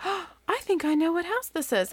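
high, delighted gasp of recognition; as if she just spotted a long-lost friend; surprised girl. Acted. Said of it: "sees the house and goes".

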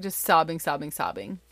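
normal talking voice

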